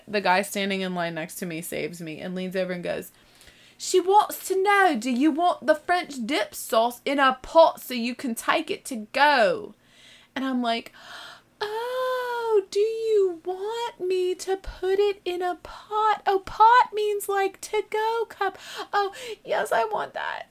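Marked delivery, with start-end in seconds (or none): 3.8-9.7 lightly voiced translator, raised and louder; a hint of a patient man stepping in; helpful bystander. Man in line translates for her. 11.6-20.4 very high, slow, teary little voice; mock-meek high-pitched relief; embarrassed realization. Sudden understanding, near tears.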